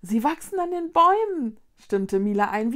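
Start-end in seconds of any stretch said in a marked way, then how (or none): 0.0-1.6 high, loud exclamation; excited wonder. A narrator's reading. Mila agrees with Konrad's discovery, excited. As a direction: join in the amazement, pitched well up.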